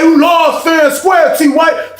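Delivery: high, loud slow outrage; high whining shout from the man who lost his tray; high offended shout. Retold or imitated. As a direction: complain loudly that it's unfair. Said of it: "He was like".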